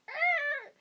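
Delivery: high screeching arr; imitating the subway wheels screeching; imitated train screech. Shown as a demonstration. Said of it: "they're like".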